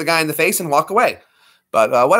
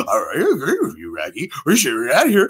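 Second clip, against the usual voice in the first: Scooby-Doo voice. Acted. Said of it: "too easy for Scooby-Doo".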